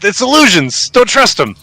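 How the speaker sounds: high-pitched crazy bandit voice; alarmed toll-keeper. Acted. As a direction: cry out a warning to unseen allies, high.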